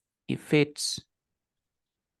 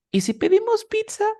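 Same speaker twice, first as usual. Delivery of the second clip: excited offer; 5 dB louder, faster than usual. Acted. Acting out offering pizza.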